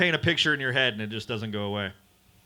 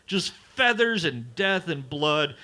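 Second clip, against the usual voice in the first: ordinary talk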